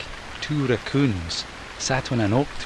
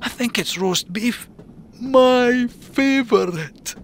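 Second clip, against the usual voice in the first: much higher, slow savouring raccoon; delighted Ian; 13 semitones higher, 3 dB louder, slower than usual. Acted. Ian to Mac, high savouring delight. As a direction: drool over the roast beef.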